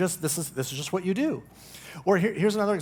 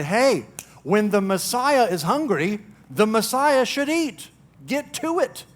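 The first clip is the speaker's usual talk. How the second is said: mock demanding Jesus; 5 semitones higher, 5 dB louder than usual. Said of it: "did Jesus walk in the door and say".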